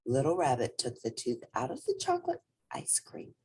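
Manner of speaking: plain reading voice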